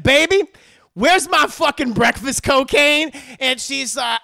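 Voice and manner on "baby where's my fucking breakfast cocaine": loud, high shout for his stash; demanding yell. Retold or imitated. Harley yelling at his girlfriend for his cocaine, loud. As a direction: bellow through the house.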